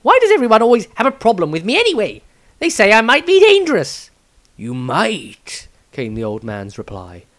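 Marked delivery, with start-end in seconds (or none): 0.0-4.1 much higher, loud and fast complaint; quick retort. Acted. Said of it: "quickly retorted". 4.6-5.7 very slow, grave elder's voice; the patriarch challenging Maestro, slow and grave; stern old patriarch. Acted.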